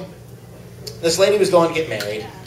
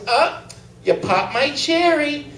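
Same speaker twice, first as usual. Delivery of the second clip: coy bride; 9 semitones higher, slower than usual. Acted. Bride's coy punchline line.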